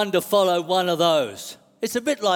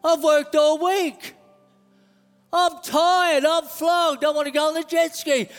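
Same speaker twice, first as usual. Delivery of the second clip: higher-pitched protest, building in force; complaining flesh guy; 7 semitones higher than usual. Acted. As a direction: play the tired flesh refusing to go. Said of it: "flesh guy over here, he's saying".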